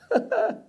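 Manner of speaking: a short scornful laugh, pitched high; high mocking laugh. Real, not acted. Preacher scoffing at what schools teach, high laugh. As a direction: laugh in disbelief at the lesson.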